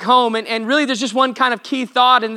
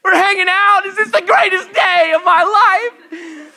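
higher, giddy voice of an overjoyed dog; excited dog voice; 9 semitones higher, 5 dB louder, slower than usual. Retold or imitated. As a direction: bounce through it like a thrilled puppy. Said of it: "dog follows into kitchen".

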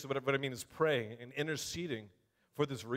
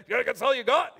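louder, higher goading taunt; taunting dare; 6 semitones higher, 8 dB louder than usual. Retold or imitated. Graham Cook taunting the satanist on the phone, loud goading. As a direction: egg the cursing caller on. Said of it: "'cause he's like".

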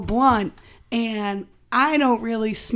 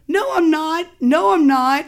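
louder, raised-pitch mock denial; insistent protest; 5 semitones higher, 6 dB louder than usual. Guest re-enacting a protest to Bill, insistent denial. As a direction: push back twice, emphatically. Retold protest to Bill's warning.

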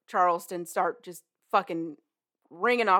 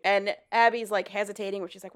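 normal talking voice

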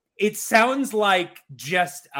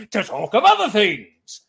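big walrus voice, louder; 4 semitones higher, 7 dB louder than usual. Shown as a demonstration. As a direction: bellow it like a blustery walrus. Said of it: "I just did that".

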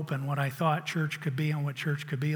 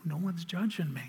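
ordinary talk

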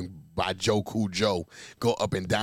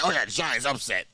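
mocking quote. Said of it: "he just jumped out and said".

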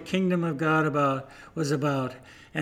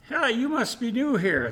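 horse cries out; 9 semitones higher than usual. Acted. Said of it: "the horse cried".

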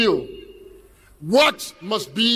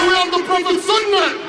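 raised, higher call to the crowd; rallying shout; 6 semitones higher, 3 dB louder than usual. Real, not acted. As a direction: work the crowd with a shouted question.

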